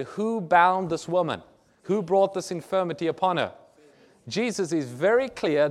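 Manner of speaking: normal talking voice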